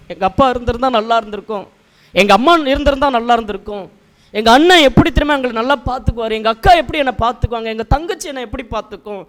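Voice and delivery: quick, high run-on recital; mock family reminiscing. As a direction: rattle it off like someone going on about their relatives. Voicing people reminiscing about their relatives.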